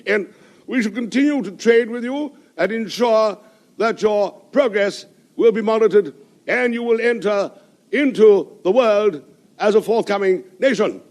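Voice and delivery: high, slow, grand colonial speech; speechifying diplomat to the crowd; pompous diplomat. Acted. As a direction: orate like a pompous British envoy.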